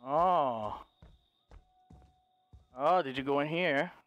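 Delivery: very low, creeping voice; low searching call. Pretend. Hunter searching houses for Ava, low searching call.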